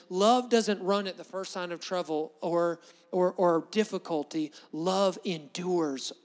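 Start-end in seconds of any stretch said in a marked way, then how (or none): 4.7-6.1 slow emphasis. Shown as a demonstration.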